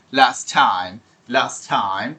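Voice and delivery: Last time with the t of last dropped; one t only in last time; last time elided. Shown as a demonstration. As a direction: glide from las straight into time. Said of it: "একই জিনিসের repetition হয় না".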